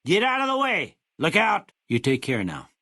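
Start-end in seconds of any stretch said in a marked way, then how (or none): 0.0-1.0 shouted warning. Acted. Warning someone in danger. 1.2-1.7 high, sharp cry of alarm; shouted warning. Acted. Warning someone in danger.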